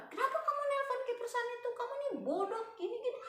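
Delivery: very high, rapid mock scolding; retold anger. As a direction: imitate an angry scammer in a high voice. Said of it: "Terus langsung dia marah".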